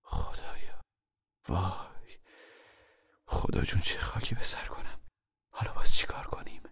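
whispered despair; weary, heartsick mother. A narrator's reading. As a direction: sigh it out under your breath, worn down. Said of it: "مادر خسته دل گفت".

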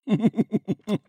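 high-pitched giggle. Acted. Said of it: "خندید".

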